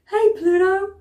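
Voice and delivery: high, loud cartoon mouse voice; Mickey Mouse. Shown as a demonstration. Singing teacher showing the cry technique, Mickey Mouse impression. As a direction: call out in a high-pitched cartoon squeak. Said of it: "sound like Mickey Mouse going".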